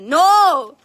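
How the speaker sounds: loud, sudden high cry; startled by what she sees; alarmed no. Real, not acted. (grito de sorpresa)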